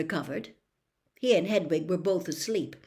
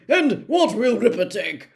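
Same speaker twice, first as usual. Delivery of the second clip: higher, louder hearty host; jovial; 5 semitones higher, 10 dB louder than usual. Acted. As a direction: welcome her with booming cheer. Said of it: "smiling jovially".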